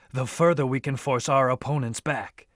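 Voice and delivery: ordinary delivery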